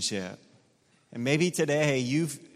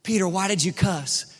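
mock accusing questions; 7 semitones higher than usual. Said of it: "here's what he doesn't ask".

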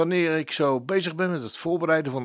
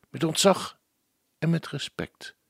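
normal talking voice

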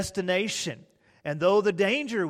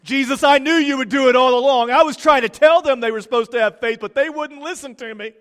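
much higher, much louder, fast bragging; boastful Simon Peter; 8 semitones higher, 11 dB louder, faster than usual. Retold or imitated. Simon Peter boasting to Jesus after the calm, loud and fast. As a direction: play the live wire claiming he knew all along. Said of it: "Simon Peter was over there going".

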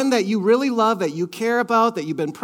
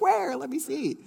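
mock child; 5 semitones higher, 6 dB quieter than usual. Child voiced, distracted from crying.

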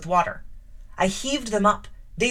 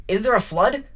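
louder, rising startled question; startled cry; 6 dB louder than usual. A narrator's reading. Rochester waking drenched, crying out in alarm. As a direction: call out, just woken and soaked. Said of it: "he cried".

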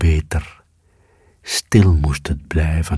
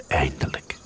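thought to herself; 3 semitones lower, 10 dB quieter than usual. A narrator's reading. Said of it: "dacht de kat".

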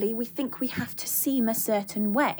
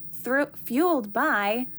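slow, stretched "fueled" with a rise; stress on fueled; 2 semitones higher, 3 dB louder, much slower than usual. Shown as a demonstration. Coach describing her coaching, slowly stressing fueled. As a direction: draw out "fueled". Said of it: "empathy fueled by genuine love".

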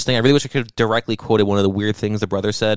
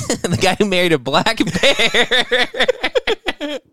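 slow, higher, laughing through it; 10 semitones higher, much slower than usual. Real, not acted. Host mocking himself while laughing, slow and higher. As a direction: crack up at your own line.

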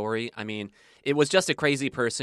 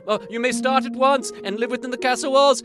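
haughty king; 8 semitones higher, 5 dB louder than usual. Acted. King to new jester.

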